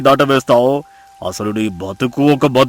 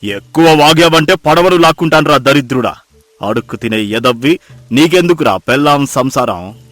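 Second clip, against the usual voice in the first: angry moneylender; 10 semitones lower, 12 dB louder than usual. Acted. Moneylender insulting the pleading debtor, angry and scornful. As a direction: cut the beggar off with contempt. Moneylender abuses the begging fisherman.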